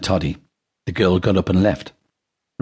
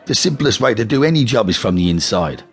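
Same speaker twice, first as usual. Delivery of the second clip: persuasive gangster; 7 semitones higher than usual. A narrator's reading. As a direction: talk his brother round. Ronnie argues with Reggie.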